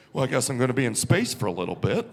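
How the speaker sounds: low, quick resigned aside. Retold or imitated. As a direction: give in with a deep, deadpan shrug. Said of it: "And I was just like".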